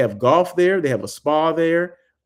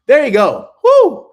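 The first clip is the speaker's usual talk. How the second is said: loud, high triumphant cheer; excited whoop; 11 semitones higher, 11 dB louder than usual. Real, not acted. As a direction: whoop it out, fired up. Worked up ending rant.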